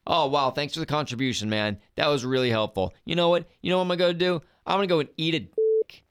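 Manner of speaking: loud, sarcastic retort; snarky, over-the-top thanks. Retold or imitated.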